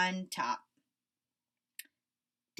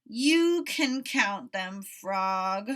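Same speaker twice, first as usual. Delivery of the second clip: grumpy Toad; 6 dB louder, much slower than usual. A narrator's reading.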